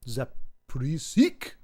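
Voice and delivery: Zapresic drawn out as "zap... pree-SEEK", rising; exaggerated trial pronunciation. Shown as a demonstration. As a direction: stretch Zapresic slowly up to a questioning SEEK. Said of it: "out of those seventeen pronunciations".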